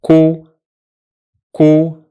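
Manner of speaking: "ku" as one short syllable; syllable drill; syllable demo. Shown as a demonstration. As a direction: say "ku" briefly, twice. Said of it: "The first syllable is".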